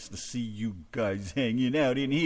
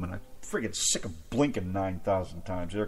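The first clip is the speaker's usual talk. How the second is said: normal talking voice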